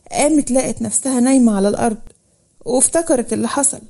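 plain reading voice

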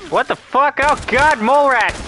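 high, loud alarmed outburst; startled yelp. Real, not acted. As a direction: cry out in sudden alarm. Sudden mole rat attack.

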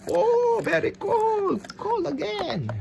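high squeal of pretend chill; troll reacting to cold. Troll testing the cold water, high pretend squeal.

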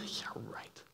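a sneering aside breathed out; mock disbelief, whispered; whispered sarcasm. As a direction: whisper it, dry and mocking.

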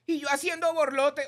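high, loud squeaky put-on; flustered and self-mocking; mock listener voice. Host voicing a listener's embarrassed reply in a high voice.

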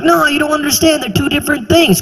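high, insistent waiter explaining; exasperated waiter. Retold or imitated. Waiter explaining grapefruit to [name], high and insistent. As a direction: plead with a confused customer. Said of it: "He's like".

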